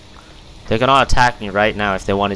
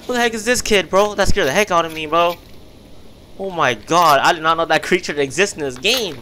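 higher, louder rattled rush; shaken excitement; 7 semitones higher, 3 dB louder than usual. Real, not acted. Player still rattled after the creature scare. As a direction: still buzzing from the fright.

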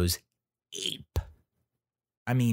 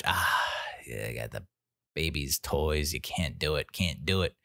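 low robber mutter; 5 semitones lower, 4 dB quieter than usual. Retold or imitated. Robber finding baby toys in the garage, low and soft. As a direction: lower and softer, lightly voiced crook.

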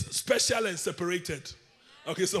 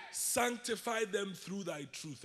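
slow lead-in reading; 9 dB quieter, slower than usual. Shown as a demonstration.

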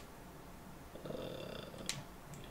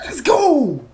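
excited; 14 semitones higher, 9 dB louder than usual. Real, not acted. Fan cheering a play.

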